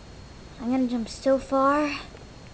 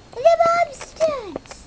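high, slow, dramatic stunt announcer call; grand announcement; 11 semitones higher, slower than usual. Acted. Toy introduces its stunt to viewers, high drawn-out showman call. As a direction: sell the stunt's name like a showman.

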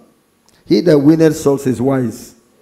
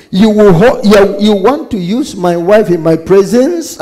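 loud, higher, indignant accusation; acted anger; 6 semitones higher, 6 dB louder than usual. King accuses Haman over his wife, acted anger. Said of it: "The anger was too much".

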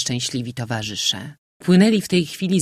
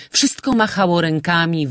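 plain reading voice

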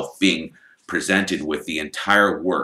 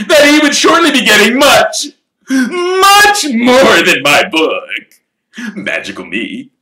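showman voice; 13 semitones higher, 10 dB louder than usual. Acted. Lockhart's big announcement.

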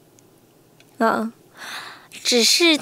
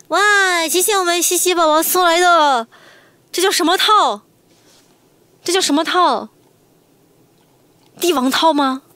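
excited high thanks; 6 semitones higher, 3 dB louder than usual. Real, not acted. Big gift arrives.

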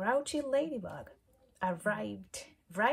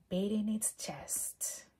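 ordinary delivery

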